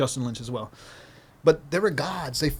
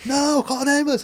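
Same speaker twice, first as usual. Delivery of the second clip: much higher, pained retold cry; mock scream; 9 semitones higher, slower than usual. Injured skater pleading for an ambulance, high mock scream. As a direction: yelp it out in mock panic. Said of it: "I'm screaming".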